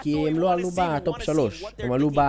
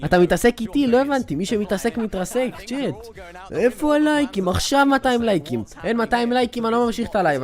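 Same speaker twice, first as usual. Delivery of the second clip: loud raised voice, playful menace; mock threat; 6 semitones higher, 3 dB louder than usual. Streamer threatening chat to close the live, mock anger. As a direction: bully chat half-jokingly.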